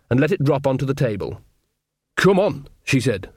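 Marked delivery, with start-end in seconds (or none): 2.2-2.7 raised-pitch, brisk urging; an insistent call to get moving; urgent summons. Acted. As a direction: hurry her along.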